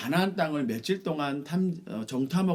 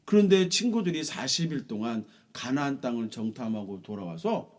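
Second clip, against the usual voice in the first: ordinary delivery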